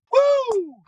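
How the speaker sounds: shrieked cheer, very high and loud; mom's scream. Retold or imitated. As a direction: imitate a mother screaming with joy. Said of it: "his mom just goes".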